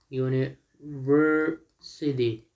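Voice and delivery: UNIVERSITY very slowly, in separated chunks; syllable by syllable. Shown as a demonstration. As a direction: break "university" into uni-ver-sity for learners. Teacher breaks word into syllables.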